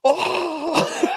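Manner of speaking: high shocked "oh" through a laugh; shocked gasp. Retold or imitated. Imagining reacting to the twist ending, laughing shocked yelp. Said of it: "I'd be like".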